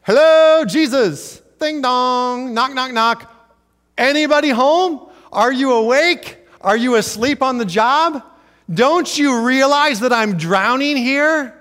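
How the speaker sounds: loud, high, panicked calling out to a sleeping Jesus; hammed-up panicked prayer shouting for help; frantic prayer. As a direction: bang on heaven's door, desperate. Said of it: "we say".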